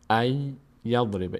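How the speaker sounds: slow "ای یضرب" with a held double y; merged Y. Shown as a demonstration. Teacher repeats the correct merging, held Y. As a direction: hold the y of "ای یضرب", no n.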